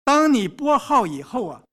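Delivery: usual speaking voice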